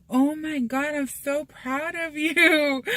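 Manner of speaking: high, drawn-out gushing in imitation; gushing praise. Partner praising her for skipping rice, imitated gushing. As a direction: imitate a proud partner, pitched well up.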